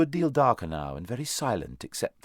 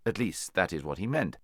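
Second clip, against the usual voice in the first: ordinary delivery, nothing marked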